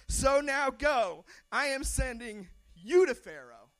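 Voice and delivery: loud, slow divine command; God ordering Moses to go. Acted. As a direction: send him off with authority. God commands in verse ten.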